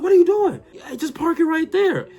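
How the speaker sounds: high, loud, panicked yell; alarmed uncle. Retold or imitated. Uncle yelling at teen niece driving the golf cart, high panicked shout. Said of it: "He said".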